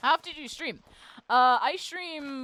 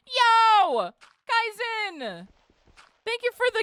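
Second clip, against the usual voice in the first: excited shout; 12 semitones higher, 11 dB louder than usual. Real, not acted. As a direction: shout the name out loud. Greeting a gifter loudly.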